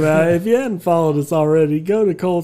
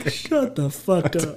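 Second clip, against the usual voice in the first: ordinary delivery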